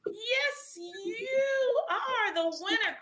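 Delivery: slow, drawn-out winner shout; excited shout. Real, not acted. Host announcing the winner to the chat, excited shout. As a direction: crown the winner with a big call.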